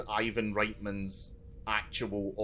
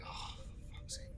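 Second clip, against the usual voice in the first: exasperated whisper; 18 dB quieter than usual. Retold or imitated. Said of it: "exasperated look, like".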